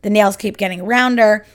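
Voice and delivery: ordinary delivery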